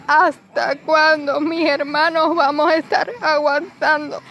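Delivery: slow, tearful cry of anger; angry crying. Real, not acted. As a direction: voice breaking with rage. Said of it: "estoy llorando de rabia".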